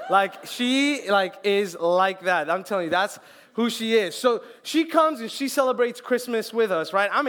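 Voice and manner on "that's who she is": normal talking voice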